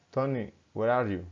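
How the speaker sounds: ordinary delivery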